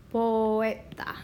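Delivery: poeta said as three syllables po-e-ta; Spanish word poeta. Shown as a demonstration. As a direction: split poeta into po-e-ta, vowels apart. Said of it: "So poeta is an example".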